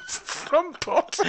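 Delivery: slow, high giggly repeat; laughing. Real, not acted. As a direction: say it through a giggle. Echo through laughter.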